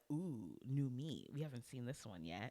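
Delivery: low, soft, conspiratorial aside; shared secret thought. Retold or imitated. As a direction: voice an intrigued thought under your breath. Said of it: "we were both thinking".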